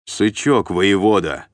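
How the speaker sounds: loud, firm military correction; stern correction. A narrator's reading. Sergeant correcting a captive's address, stern and loud. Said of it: "поправил его десятник".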